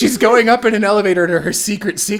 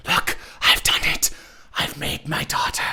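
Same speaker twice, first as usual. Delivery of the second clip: eerie near-whisper, much softer, breathy and unvoiced; creepy scientist; 7 dB quieter than usual. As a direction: hush it out like a creepy mad scientist. Retelling mad scientist's creation scene.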